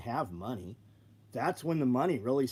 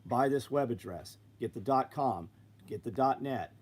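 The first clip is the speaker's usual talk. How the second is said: ordinary delivery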